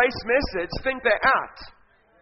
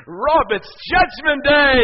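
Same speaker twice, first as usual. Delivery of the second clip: loud, high, slow proclamation; grand announcement; 6 semitones higher, 8 dB louder, much slower than usual. Acted. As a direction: stretch it out like a big proclamation. Jesus announces judgment day.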